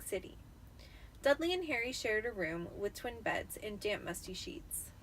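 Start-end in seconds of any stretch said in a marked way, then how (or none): none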